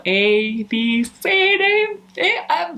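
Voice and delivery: letters recited oddly, D, E and F jumping high and loud; odd letter pronunciation. Shown as a demonstration. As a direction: start A, B, C evenly, then shoot up on D, E, F. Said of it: "how you pronounce letters".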